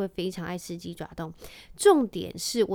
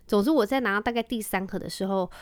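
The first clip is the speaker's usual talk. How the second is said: ordinary talk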